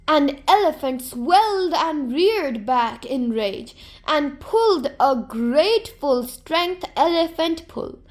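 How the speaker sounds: usual speaking voice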